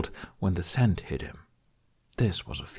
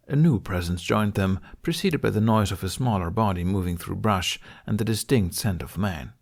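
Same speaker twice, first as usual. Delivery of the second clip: ordinary delivery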